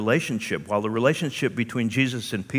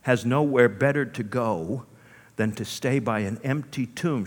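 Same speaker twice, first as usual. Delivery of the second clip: ordinary talk, nothing marked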